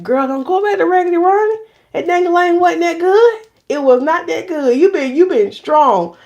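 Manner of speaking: sassy self talk. Retold or imitated. [name] coaching herself to stay strong, lightly sassy. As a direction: gently scold yourself like a friend. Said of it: "That's how I talk".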